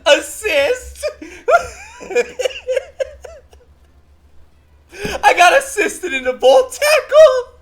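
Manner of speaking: very high, loud wail through laughter; hysterical laughing disbelief. Real, not acted. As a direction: shriek it, half laughing, half crying. Hysterical at unlucky move in game.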